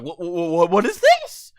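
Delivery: can't believe what you just saw; shocked disbelief. Real, not acted. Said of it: "I'm like".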